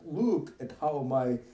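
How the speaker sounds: lightly voiced Count Chocula vampire; a hint of a cartoon vampire. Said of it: "I'm doing, like, a, a Count Chocula voice".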